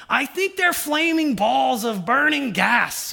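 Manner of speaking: slow, bellowing Pumbaa impression. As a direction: boom it out like Pumbaa. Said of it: "Pumbaa's like".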